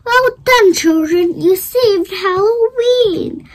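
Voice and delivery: a hint of a proud old wizard; cheering wizard. A narrator's reading. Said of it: "they cried".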